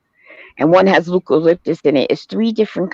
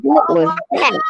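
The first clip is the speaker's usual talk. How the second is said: high startled what was that; startled question; 11 semitones higher than usual. Retold or imitated. Retelling being startled in the night, high startled question. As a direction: ask it like you just got spooked. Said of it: "I said".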